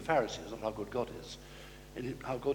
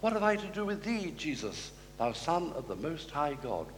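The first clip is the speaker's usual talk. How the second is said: ordinary talk, nothing marked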